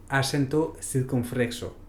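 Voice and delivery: ordinary talk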